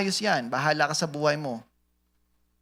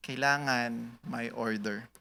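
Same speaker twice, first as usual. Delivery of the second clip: slow, much softer echo; soft repeat; 5 semitones lower, 8 dB quieter, slower than usual. Shown as a demonstration. Pastor repeating his point to the church, slow and soft. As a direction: let it sink in quietly. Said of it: "O kailangan may order".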